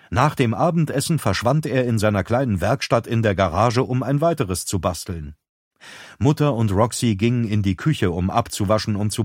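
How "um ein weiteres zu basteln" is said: plain reading voice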